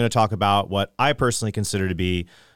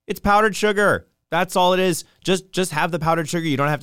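exasperated; 9 semitones higher than usual. Real, not acted. Exasperated rant about sugar.

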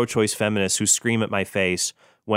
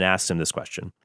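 ordinary talk

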